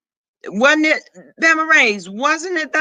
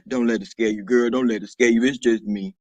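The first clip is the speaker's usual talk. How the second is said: very low, softer deep register; 12 semitones lower, 3 dB quieter than usual. Acted. Reassuring a viewer the scary voice is just me, deep voice. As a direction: sink right down low, a little quieter. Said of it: "That boy scared me".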